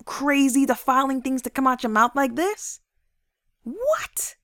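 high, indignant telling-off, acted out; self-scolding. Speaker catching herself talking negatively, indignant scolding. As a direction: scold yourself in mock disbelief.

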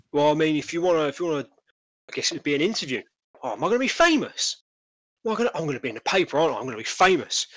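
lightly voiced trader, tickled by attention; excited stallholder. Stallholder learning he will be interviewed, excited. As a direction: lift the pitch with eager, self-important excitement.

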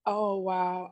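normal talking voice

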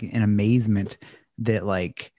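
normal talking voice

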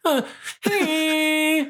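high honking horn sound; klaxon impression. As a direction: blare it like a klaxon. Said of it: "that's a good klaxon impression".

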